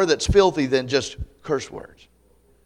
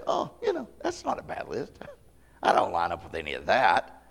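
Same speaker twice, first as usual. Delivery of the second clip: smug; 8 semitones lower, 5 dB quieter than usual. Retold or imitated. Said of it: "they say".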